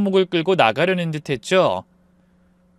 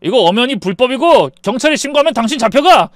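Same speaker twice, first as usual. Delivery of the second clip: stammering start, building louder; panicked shouting; 5 semitones higher, 6 dB louder than usual. A narrator's reading. Husband threatening to call police on the mother, panicked shout. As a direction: protest in alarm, voice raised. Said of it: "하얗게 질린 상태로 소리쳤죠".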